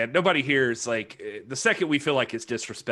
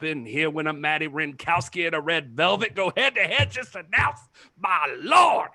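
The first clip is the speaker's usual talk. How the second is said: mock preacher plug; 3 semitones higher than usual. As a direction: fire up the congregation for the show. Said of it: "do that plug there as a Presbyterian might do it".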